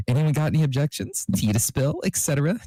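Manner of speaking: high, sassy priest voice; sassy beauty guru officiating a wedding, pitched high; James Charles. A narrator's reading. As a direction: camp it up as a gossipy priest. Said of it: "James asked".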